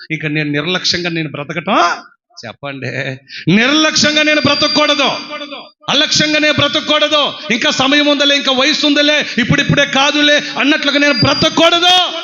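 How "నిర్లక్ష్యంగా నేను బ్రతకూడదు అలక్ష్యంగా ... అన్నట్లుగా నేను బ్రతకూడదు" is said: higher, emphatic sermon lines; preacher urging the congregation; fervent preaching. Acted. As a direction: drive the warning home. Preacher rising again.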